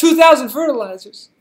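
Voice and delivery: high, loud whoop over the number; excited exclamation. Real, not acted. Player calling out a bigger reward, excited.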